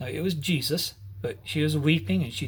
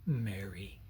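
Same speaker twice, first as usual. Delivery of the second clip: much lower and much softer, full of affection; loving and tender; 7 semitones lower, 11 dB quieter than usual. Acted. Jesus calling Mary's name with love, hushed and tender. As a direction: breathe her name with deep love. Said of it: "Much, much love and affection".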